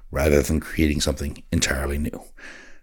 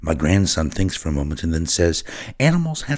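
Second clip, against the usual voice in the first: earnest first-person story reading; a gentle, measured storytelling voice; story narration; 3 semitones higher than usual. A narrator's reading. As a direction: read it like a grandmother telling her tale. Reads his written story aloud.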